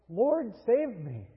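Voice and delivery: mild cry out. Shown as a demonstration. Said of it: "remembering to cry out".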